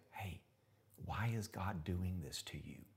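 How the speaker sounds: slow, hushed whisper in your ear; devil's whisper. Retold or imitated. Devil whispering doubt into a listener's ear, slow and hushed. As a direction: breathe the doubt softly. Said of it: "get in your face and say".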